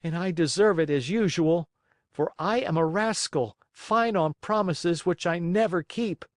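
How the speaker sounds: a hint of a remorseful boy; Pinocchio voice. Pinocchio's voice in the story.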